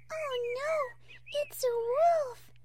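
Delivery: very high, drawn-out mock-frightened cry; play-acted cry of terror. Fluttershy acting out a wolf attack, mock terror. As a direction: wail in pretend terror, high and stretched. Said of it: "gave out a cry of terror".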